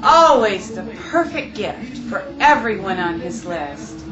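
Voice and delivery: ordinary delivery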